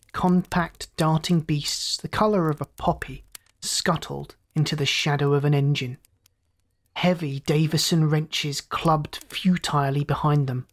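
ordinary delivery

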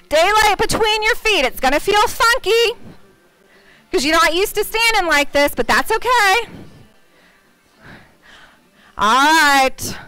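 usual speaking voice

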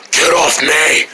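low, growling scary voice; scary deep voice. Acted. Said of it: "Where you get that voice from?".

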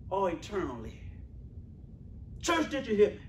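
ordinary talk, nothing marked

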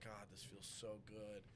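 slow, soft relieved murmur; relieved sigh. Retold or imitated. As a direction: sink into the floor with relief. Lying on floor, relieved.

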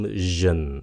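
"jin" with a voiced, buzzing zh; "jin" sound. Shown as a demonstration. Teacher introduces second ending sound, "jin" voiced zh. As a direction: say "jin" with a buzzed first consonant. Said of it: "âm thứ nhì là âm".

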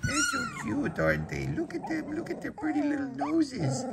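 high tender puppy talk. Real, not acted. Cooing at puppies.

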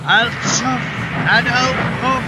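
defiant. A narrator's reading. Toby defying the ghost.

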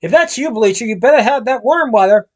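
high, loud harsh voice; harsh woman voice. A narrator's reading. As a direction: bark it through the door. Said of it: "a harsh, gray voice".

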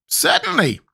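very high squeaky creature; high-pitched squeaky character; Gump's squeaky voice. A narrator's reading. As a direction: squeak it out, far above your own voice. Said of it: "answered the Gump".

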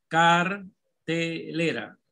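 cartelera very slow, in separate syllables. Shown as a demonstration. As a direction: dictate "cartelera" as car, te, lera with gaps. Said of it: "La van a dividir en sílaba".

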